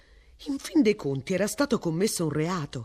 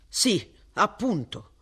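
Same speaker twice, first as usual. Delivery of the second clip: slow, dry and clipped reply; curt; 4 semitones higher, slower than usual. A narrator's reading. Mister Ransom answering Martin, dry and curt. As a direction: cut the young man short. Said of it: "disse seccamente".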